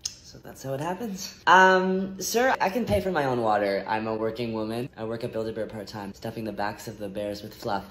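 low awkward date voice. Acted.

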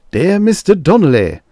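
high, loud letter voice; letter opening. Acted. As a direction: start another letter brightly. Next letter begins.

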